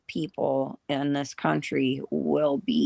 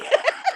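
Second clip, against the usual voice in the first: giggle; 3 dB louder than usual. Real, not acted. Giggle after soda.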